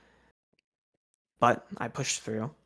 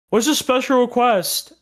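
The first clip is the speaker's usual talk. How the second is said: loud, high mock call; raised voice, pitched well up; retold demanding shout; 11 semitones higher, 8 dB louder than usual. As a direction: imitate coworkers yelling a question across. Coworkers calling back about the order.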